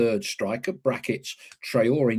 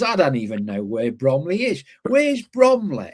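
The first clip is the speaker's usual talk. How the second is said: put-on Merseyside accent; 4 dB louder than usual. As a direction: mimic a frustrated Scouse commentator in a Merseyside accent. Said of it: "In his thick Merseyside accent".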